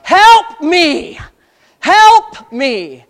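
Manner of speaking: high, loud, drawn-out cry for help; preacher imitating anguished screams; crying out from hell. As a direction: wail it as a plea from hell. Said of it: "That's what hell is crying out".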